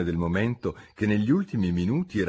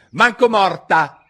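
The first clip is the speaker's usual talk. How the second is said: loud, high, slow refusal; defiant cook; 12 semitones higher, 10 dB louder, slower than usual. A narrator's reading. Cook refuses the king's order to testify, defiant. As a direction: refuse point-blank in a raised voice.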